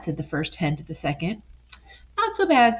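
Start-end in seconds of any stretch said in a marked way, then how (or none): 2.2-2.8 high prim echo; hen echoing. Acted. Second hen agreeing with the first, high. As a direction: parrot it brightly.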